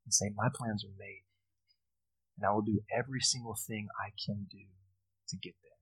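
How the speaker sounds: very quiet, sincere pastoral close; hushed earnest appeal. Real, not acted. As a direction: urge them quietly, with weighty pauses. Earnest closing appeal to congregation.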